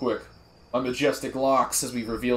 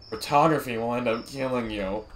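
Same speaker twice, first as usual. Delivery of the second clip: normal talking voice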